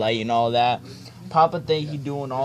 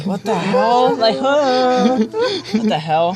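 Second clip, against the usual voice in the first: disbelief; 9 semitones higher, 6 dB louder, much slower than usual. Real, not acted. Shocked at the picture.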